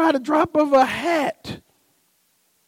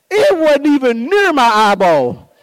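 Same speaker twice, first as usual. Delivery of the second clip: normal talking voice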